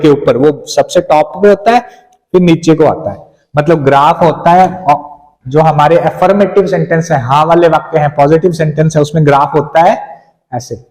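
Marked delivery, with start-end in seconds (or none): none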